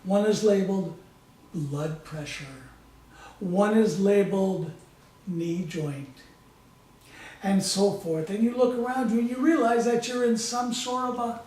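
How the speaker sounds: calm, measured hypnotic storytelling voice; guided hypnosis. Acted. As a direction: lead them gently through a visualization, pausing often. Leads a guided visualization.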